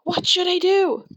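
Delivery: panicking little creature; high frightened voice; scared unicorn. A narrator's reading. Sparkle scared inside thoughts.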